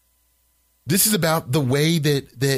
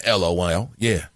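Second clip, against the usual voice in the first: ordinary delivery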